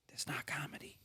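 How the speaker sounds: whispered to himself; inner whisper. Retold or imitated. Thinking on stage in North Carolina, whispered inner voice. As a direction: whisper it like a private thought. And in my head.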